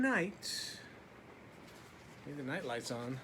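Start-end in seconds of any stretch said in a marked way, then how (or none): none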